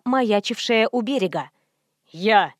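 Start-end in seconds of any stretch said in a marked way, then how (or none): 2.1-2.5 low, rasping single grunt; low hoarse voice. A narrator's reading. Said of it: "Голос был низкий, хриплый".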